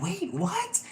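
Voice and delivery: high, sharp mock double take; shocked. Imitating a reader stunned by a sign, sharp high mock surprise. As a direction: leap up in pitch in disbelief. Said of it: "you'll be like".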